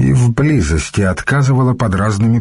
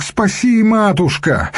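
very high pleading wail; wailing plea; 13 semitones higher than usual. A narrator's reading. Young monk begs the matushka to save him, wailing. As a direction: cry high for rescue. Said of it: "заголосил монашек".

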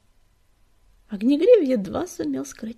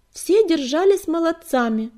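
light, higher female cat voice; bright-spirited loud praise, pitched up; approving call; 7 semitones higher, 9 dB louder than usual. A narrator's reading. Another cat calls from behind.

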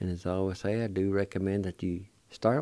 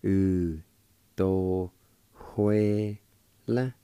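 "U, do, hue, la" as separate held syllables; much slower than usual. Shown as a demonstration. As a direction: pause after each part of "U, do, hue, la". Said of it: "It's pronounced like this".

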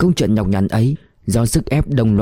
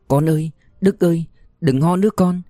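put-on higher, trembling plea to a sick baby; tearful farewell; 6 semitones higher than usual. A narrator's reading. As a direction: say goodbye fighting back sobs. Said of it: "Tôi khóc nấc lên". (giọng mẹ nghẹn ngào từ biệt con)

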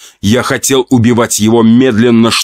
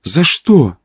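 higher female voice; Olya's voice; 7 semitones higher than usual. Acted. Narrator speaking through Olya to Pyotr, in her voice. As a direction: ask in a higher woman's voice. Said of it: "спросил я голосом Оли".

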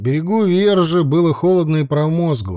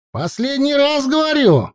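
high rallying cry; shout; 12 semitones higher than usual. Acted. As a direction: call out over the wailing. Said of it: "крикнул он".

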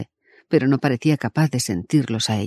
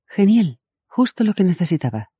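sarcastic; 6 semitones higher than usual. A narrator's reading. Sarcastic aside.

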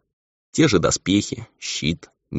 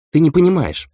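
high, louder upset outburst; distraught, raised voice; exclaiming; 11 semitones higher, 7 dB louder than usual. A narrator's reading. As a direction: cry out in frustration. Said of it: "воскликнул Энджи".

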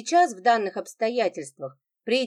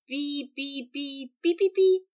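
Morse code beeping; 6 semitones higher, slower than usual. Acted. Lida taunting her silent husband with mock Morse beeps. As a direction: chirp it like a telegraph key. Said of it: "на азбуке Морзе".

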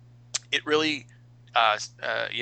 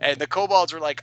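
usual speaking voice

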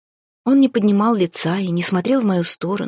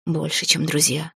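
quiet, sorrowful admission; understated sorrow, a little softer; sadly; 3 dB quieter than usual. Acted. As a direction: say it softly, with a touch of sadness. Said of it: "печально ответил он".

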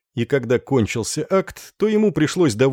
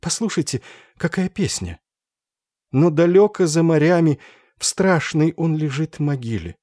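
mad Ophelia; 5 semitones higher than usual. A narrator's reading. Mad Ophelia sings again.

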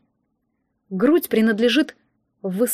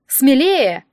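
smirking; 3 semitones higher, 5 dB louder than usual. Acted. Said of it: "усмехнулся инструктор".

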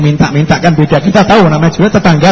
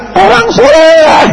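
high, slow shouted call across a distance; 11 semitones higher, slower than usual. Acted. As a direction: cry out his name from far away. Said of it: "kelihatan teriak". (teriakan keras memanggil dari jauh)

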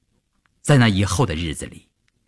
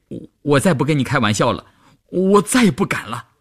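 put-on stammering, higher-pitched promise from a scared boy; weak-kneed fright; 5 semitones higher than usual. A narrator's reading. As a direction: plead nervously, voice rising. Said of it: "关京阳双腿发软地说". (吓得腿软，结巴着求饶)

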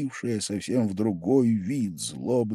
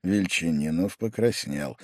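usual speaking voice

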